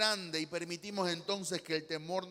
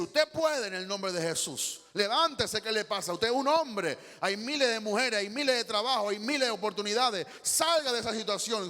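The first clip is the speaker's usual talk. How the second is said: louder, higher, driving the son to get up; rousing pep talk; 3 semitones higher, 6 dB louder than usual. Retold or imitated. Parent shouting courage into a despondent son, loud rousing. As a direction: shout encouragement at a sunken son. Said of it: "usted le dice".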